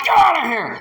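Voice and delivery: shouted, high and loud; furious crowd ordering him out; angry shout. Acted. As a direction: drive him out with a yell. Pharisees reviling the man.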